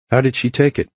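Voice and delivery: calm and concerned, answering his call; a hint of the caring doctor; lightly voiced female doctor. A narrator's reading.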